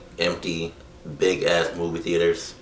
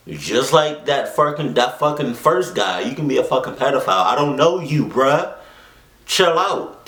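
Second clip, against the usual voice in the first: high-pitched, worked-up play-acted protest; hammed-up indignant refusal; mock indignant rebuff; 4 semitones higher than usual. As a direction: tell off an imagined stranger, pitch climbing. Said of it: "That's what I would've said".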